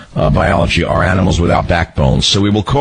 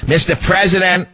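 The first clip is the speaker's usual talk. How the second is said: high yelled call to the president; mock reporter yell; 10 semitones higher than usual. Reporter calling out to the president, mock yell. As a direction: shout it like a pushy reporter. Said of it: "who used to yell out".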